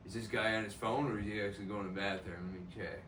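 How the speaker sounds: like thinking aloud so nobody hears; hushed inner voice. Retold or imitated. Guy checking on him in stall.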